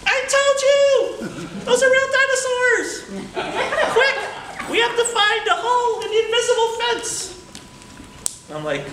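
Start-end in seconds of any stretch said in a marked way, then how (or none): 0.1-7.3 high, loud monkey voice, laughing; excited talking monkey. Acted. Monkey boasting the dinosaurs are real, high and loud. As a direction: crow it out like a thrilled little monkey.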